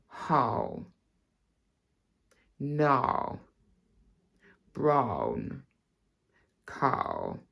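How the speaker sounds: RP drill, long pauses between words; RP accent. Shown as a demonstration. Teacher showing the C in cow sounds like K, RP accent. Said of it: "when you're saying the word cow".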